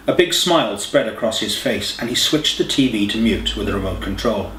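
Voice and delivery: ordinary delivery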